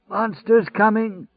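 high, slow, hoarse crying out; hoarse crazy shouter. A narrator's reading. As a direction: howl it like a lunatic prophet. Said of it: "the faint, hoarse voice cried".

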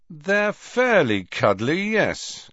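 slow, high, grudging agreement; conceding. A narrator's reading. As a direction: grant the point before objecting. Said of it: "conceded Fred".

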